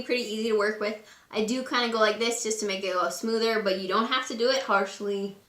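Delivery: ordinary talk, nothing marked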